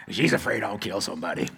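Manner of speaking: low, soft, quick cowboy punchline; Brent. Retold or imitated. Cowboy friend explaining his wife's worry, low imitated cowboy voice. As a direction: imitate an old cowboy, deep and deadpan. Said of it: "He talks like this".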